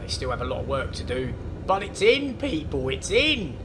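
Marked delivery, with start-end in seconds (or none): 1.7-3.6 excited announcement. Real, not acted. Excited the water is in.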